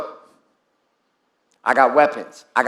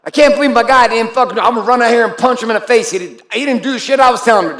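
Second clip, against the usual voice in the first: higher, louder, fast mock rant; retold angry outburst, quick and raised; imitating pissed friend; 6 semitones higher, 5 dB louder, faster than usual. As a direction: play the furious guy backstage, hammed-up. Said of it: "he's pissed".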